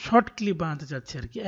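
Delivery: normal talking voice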